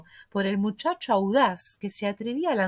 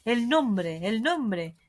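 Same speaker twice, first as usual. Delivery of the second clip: ordinary delivery, nothing marked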